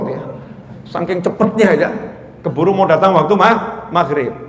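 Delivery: ordinary delivery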